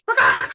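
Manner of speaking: low mock rooster squawk; imitating the rooster squawking at them. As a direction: squawk it back like a cocky rooster.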